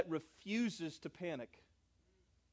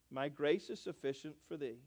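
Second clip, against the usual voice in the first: solemn quote; 6 semitones lower, 13 dB quieter than usual. Acted. Scripture quote, hushed.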